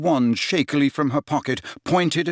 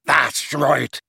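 short angry roar. A narrator's reading. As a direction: roar it back at him. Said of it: "roared Gaunt".

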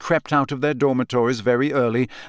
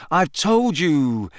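higher, slow "told you"; mildly exasperated, pitched up; weary exasperation; 4 semitones higher, much slower than usual. A narrator's reading. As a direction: draw it out with mild impatience. Harry pressed to repeat himself.

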